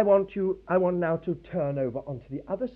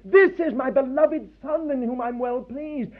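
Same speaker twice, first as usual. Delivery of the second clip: bursting out with joy, a little higher; spontaneous cry of joy; 6 semitones higher than usual. Retold or imitated. God to his Son at baptism, joyful outburst. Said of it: "burst out in a kind of spontaneous cry".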